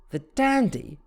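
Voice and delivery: amused disbelief with a grin; amused snort. Acted. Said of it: "she snorted with a grin".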